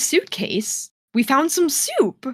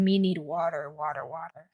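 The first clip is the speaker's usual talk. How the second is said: croaking lower and softer, a man dying of thirst; parched croak; 5 semitones lower, 9 dB quieter than usual. Pretend. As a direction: rasp it out like someone crawling through a desert.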